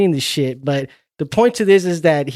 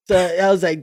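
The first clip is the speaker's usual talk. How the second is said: ordinary delivery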